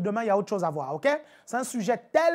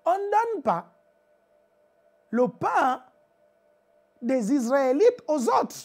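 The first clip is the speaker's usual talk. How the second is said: Jesus refusing; 3 semitones higher, slower than usual. Acted. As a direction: hold the line firmly. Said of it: "Jésus lui dit".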